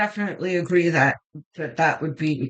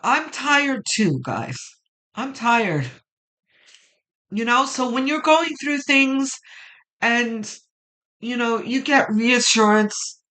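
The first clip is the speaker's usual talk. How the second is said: tearful; 5 semitones higher, 4 dB louder than usual. Real, not acted. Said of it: "why, why I wanna cry".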